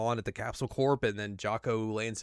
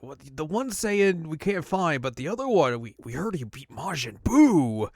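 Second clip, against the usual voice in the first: soldiers reporting; 5 semitones higher, 4 dB louder than usual. Retold or imitated. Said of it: "They're like".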